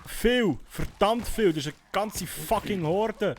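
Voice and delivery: high, loud alarmed yelling; panicked shouting. Real, not acted. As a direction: shout out the danger. Panicked calling out in fight.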